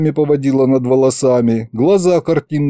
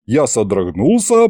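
ordinary delivery, nothing marked

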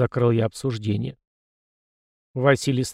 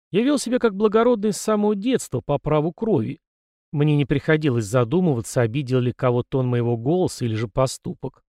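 ordinary delivery

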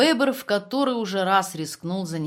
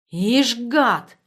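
sullen, puzzled; 5 semitones higher, 7 dB louder than usual. Acted. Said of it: "угрюмо и озадаченно сказал".

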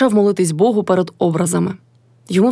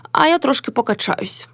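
plain reading voice

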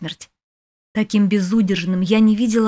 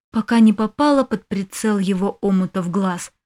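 ordinary delivery, nothing marked

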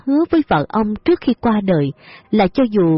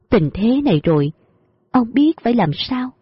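ordinary delivery

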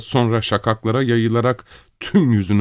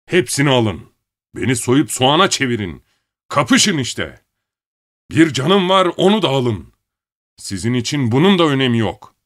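yelling at full voice; furious shouting; 6 semitones higher than usual. A narrator's reading. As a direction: bellow it out in rage. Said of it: "Avazı çıktığı kadar bağırarak".

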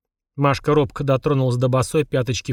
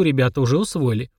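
plain reading voice

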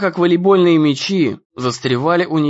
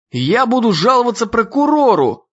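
high, raised indignant shout; 7 semitones higher than usual. A narrator's reading. Ian shouts at Alistair, high and indignant. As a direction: yell your threat at him. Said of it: "кричал Иэн".

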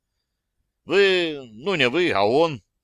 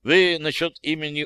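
ordinary delivery

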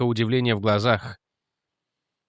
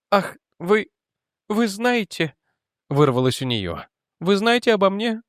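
higher, breathless confession; distraught young woman; 7 semitones higher than usual. Acted. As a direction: spill it out in distress. Jennet voiced higher.

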